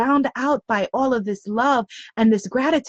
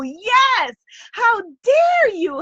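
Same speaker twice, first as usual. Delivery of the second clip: much higher, exultant cheer; joyful exclamation; 9 semitones higher, slower than usual. Acted. Guide cheering the listener's daring, exultant. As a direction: burst out in delight. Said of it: "celebrating the daring".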